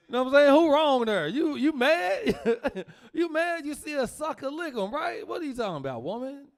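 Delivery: high, loud, laughing; incredulous. Real, not acted. Comic ribbing mama to the crowd, high and loud. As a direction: push the disbelief up high. Mocking mom.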